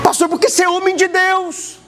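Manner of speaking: woman insisting the prophetess is anointed; voicing a believing woman. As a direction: gush about how holy she is.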